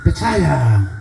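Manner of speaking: low, falling, careless reply; offhand answer. Acted. Petrus answering Tuhan without meaning it, offhand. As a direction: toss it off without thinking. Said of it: "Kalau tadi Tuhan hanya jawab, asal jawab saja".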